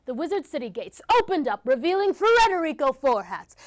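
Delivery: ordinary delivery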